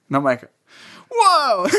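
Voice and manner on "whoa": high, loud startled "Whoa". Retold or imitated. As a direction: recoil in surprise. Reacting to being told to bite harder.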